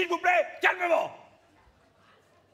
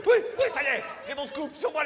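normal talking voice

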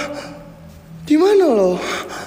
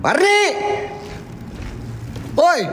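high shout across the house; calling out; 10 semitones higher than usual. Real, not acted. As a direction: yell her name to reach her far away. Calling friend from afar. (berteriak memanggil dari jauh)

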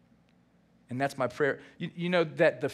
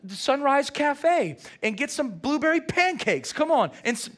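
eager invite; 9 semitones higher, 4 dB louder than usual. Retold or imitated. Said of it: "And you say".

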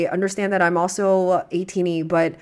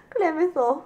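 calling pet; 9 semitones higher than usual. Real, not acted. Calling the pet's name.

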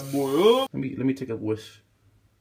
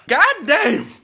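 hyped outburst; 8 dB louder than usual. Real, not acted. Outburst after chugging coffee.

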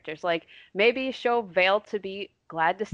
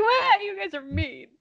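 put-on high whiny teen voice; whiny teen tantrum; 14 semitones higher, 6 dB louder, slower than usual. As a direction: whine like a sulky teenager. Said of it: "throw a tantrum".

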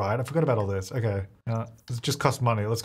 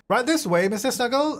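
polite vet voice; 9 semitones higher than usual. Acted. Guiding the pet owner.

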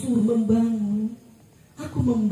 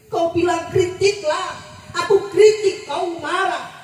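rising outcry; 8 semitones higher than usual. Acted. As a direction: build the anger line by line. Poem builds to climax. (seruan yang makin tinggi dan keras)

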